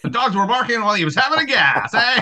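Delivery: put-on a Canadian-sounding old-timer tacking on eh; mock old radio slang voice; old-timey twenties voice.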